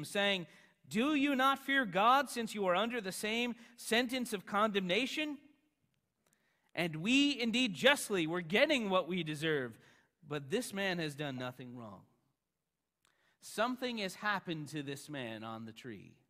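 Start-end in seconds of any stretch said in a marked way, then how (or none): 0.9-12.1 loud, raised rebuke in a preacher's reading; stern rebuke. A narrator's reading. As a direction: rebuke him with force and higher pitch. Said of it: "The other criminal rebuked him".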